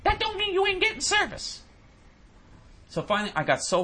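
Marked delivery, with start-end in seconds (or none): 0.0-1.6 imitating the supervisor. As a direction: parody her as dismissive. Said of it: "She said".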